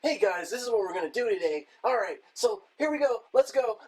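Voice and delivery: put-on high-pitched kids' show host intro; Coyote Peterson. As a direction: chirp it to the kids watching, imitating a presenter. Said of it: "he talks like he's just, like, there's nothing but kids watching".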